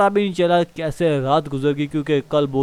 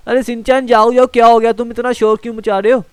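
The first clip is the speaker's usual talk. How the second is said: higher and louder, waking him; loud, startled; 6 semitones higher, 6 dB louder than usual. Acted. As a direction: shake him awake with your voice. Waking the screaming boy. (ज़ोर से, घबराकर जगाना)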